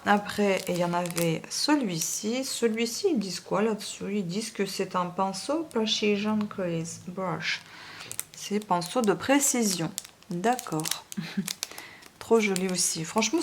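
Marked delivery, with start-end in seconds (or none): 5.7-7.6 slow English in a French accent; halting French-accented English; terrible English accent. Real, not acted. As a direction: sound out the English name with French vowels. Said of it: "mon accent anglais terrible".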